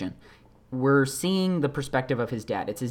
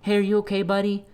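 higher-pitched, imitated pet-soothing voice; comforting a puppy; 6 semitones higher, faster than usual. Young man checking on a scared puppy, imitated tender concern. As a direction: coo it to a frightened puppy. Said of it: "leans into the puppy and grabs".

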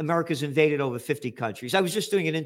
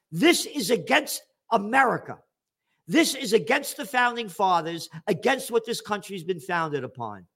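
ordinary delivery

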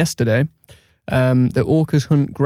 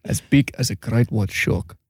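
put-on slow South African accent slipping into Russian; Russian-sounding accent; 4 semitones lower, 3 dB quieter than usual. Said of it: "that was more Russian than anything".

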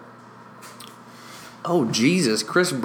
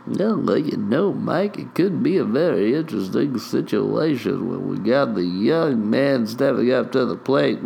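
hammed-up sing-song analyst voice; wavering mock commentator; color commentator Bob. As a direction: ramble back to your broadcast partner in a put-on voice.

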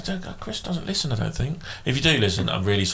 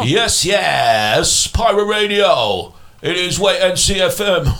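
loud, high pirate radio DJ shout; 6 semitones higher, 5 dB louder, slower than usual. Acted. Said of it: "I do it in my pirate radio voice".

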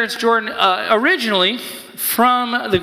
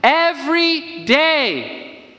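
much higher, very slowly drawn out; slow emphasis; 8 semitones higher, much slower than usual. Acted. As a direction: savour each word slowly. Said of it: "and it literally says".

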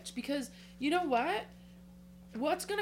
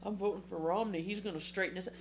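lower, softer mock of a devout voter; 5 semitones lower, 6 dB quieter, faster than usual. Mocking right-wing Christians voting for Romney, lower and softer. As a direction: drop low and soft to mimic a churchgoer. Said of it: "Christians are saying".

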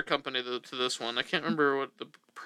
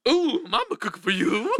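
raised, goofy cartoon-bear voice; more Yogi Bear than Italian; 6 semitones higher than usual. Shown as a demonstration. Host contrasting his take as a Yogi Bear cartoon voice. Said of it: "a Scooby-Doo, Yogi Bear kind of vibe".

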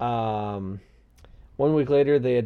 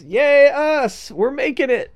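excited; 12 semitones higher, 7 dB louder, slower than usual. Real, not acted.

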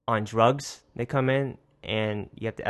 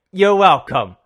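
loud, higher mock echo of his snide tone; 7 semitones higher, 13 dB louder, slower than usual. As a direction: throw his rudeness right back at him, loud. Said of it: "Like, the way he did it".

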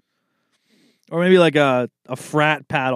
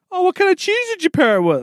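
snooty cheese guest; faster than usual. Retold or imitated. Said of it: "someone asks at a party".

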